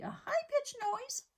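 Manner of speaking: a high squeak on "a high-pitched noise", like a mouse; squeaky voice. Shown as a demonstration.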